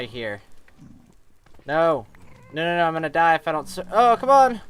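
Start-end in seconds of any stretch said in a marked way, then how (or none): 1.7-4.6 loud panicked no no no; panicked yelling. Real, not acted. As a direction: yell it out as you're about to die. About to die in game.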